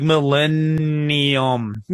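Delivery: Millennium stretched slowly on the doubled N; extra N's sounded. Shown as a demonstration. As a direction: say Millennium slowly, leaning on "LENN". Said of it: "these extra N's".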